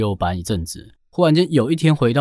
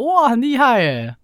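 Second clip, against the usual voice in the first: high, drawn-out wow of admiration; mock-awed, pitched well up and stretched; imitated amazed praise; 11 semitones higher, slower than usual. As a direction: marvel like an impressed crowd, slowly. Relatives marvel at reciting toddler.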